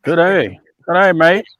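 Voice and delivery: playful mock Australian greeting; Australian accent. Host greeting an Australian viewer, mock Australian accent. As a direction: put on a light Aussie accent. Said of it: "everybody's gotta try to do the accent".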